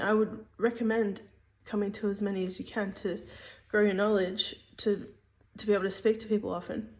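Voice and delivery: ordinary talk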